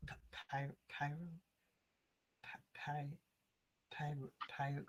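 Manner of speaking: mumbling. Acted. Player voicing her muttered attempts at pyro, mumbling. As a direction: mumble under your breath, stumbling on the first syllable. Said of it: "You hear her mumbling".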